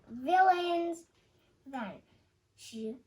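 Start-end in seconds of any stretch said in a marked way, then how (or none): 0.1-1.0 loud, high exclamation; crying out. A narrator's reading. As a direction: cry it out playfully. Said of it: "Madame Bada Bada cried".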